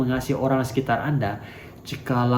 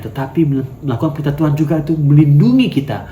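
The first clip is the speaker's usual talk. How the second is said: louder, driving sermon delivery; forceful preaching; 5 dB louder than usual. Acted. Preacher pushes the church toward loving God, loud.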